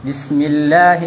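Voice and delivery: "bismillah" said without the held s; short "bismillah" shown as incorrect; wrong bismillah. Shown as a demonstration. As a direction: give "bismillah" as the error, s unstretched. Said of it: "Jadi bukan dibaca bismillah".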